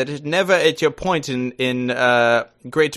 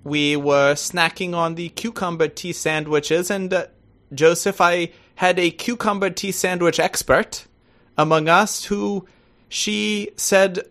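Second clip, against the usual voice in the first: put-on posh-phrased British accent. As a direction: play the very British guest, drawing out the formal phrasing. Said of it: "it's such a good accent".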